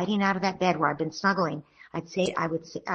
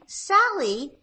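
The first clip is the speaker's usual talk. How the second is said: put-on parent talking to child; 10 semitones higher than usual. Shown as a demonstration. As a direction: lift way up and coo to the child. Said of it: "And then".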